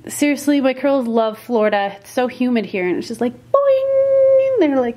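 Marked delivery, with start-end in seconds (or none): 3.3-4.6 high, loud, drawn-out boing; springy boing. Retold or imitated. Daughter showing how curls spring up in humidity, cartoon boing. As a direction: imitate a spring with a long held note.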